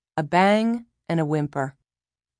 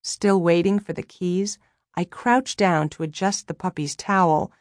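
usual speaking voice

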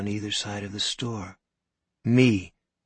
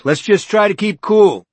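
higher, louder calming shout; 10 semitones higher, 13 dB louder than usual. Acted. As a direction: shout to calm the crowd. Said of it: "shouting to be heard over the noise".

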